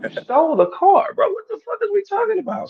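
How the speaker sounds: high-pitched disbelief; incredulous. Real, not acted. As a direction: cry out in disbelief. Incredulous outburst at theft.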